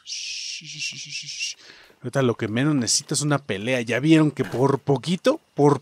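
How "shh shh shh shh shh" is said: soft hushing breath to keep quiet; shushing. Real, not acted. Streamer hushing so the enemies won't notice, soft shushing. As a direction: shush hard so nobody hears.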